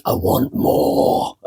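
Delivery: slow, whispered greedy gremlin. Retold or imitated. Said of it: "like a greedy gremlin voice".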